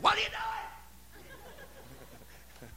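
high, drawn-out holler; mock yell. Preacher imitating an engine revving high, mock high yell. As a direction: cry it out high and stretched. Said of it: "It's like".

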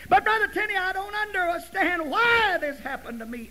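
high, loud, pleading complaint; protesting churchgoer. Acted. Voices a puzzled churchgoer's protest.

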